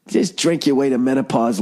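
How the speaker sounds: ordinary talk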